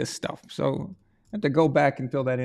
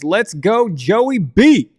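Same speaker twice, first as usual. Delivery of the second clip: loud, raised rallying cry; cheering; 4 semitones higher, 7 dB louder, much slower than usual. Retold or imitated. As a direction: cheer it like a rooting fan. Reads out fan's cheering message.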